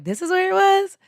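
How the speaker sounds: high, incredulous question; astonished question. Retold or imitated. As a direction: imitate a stunned girlfriend.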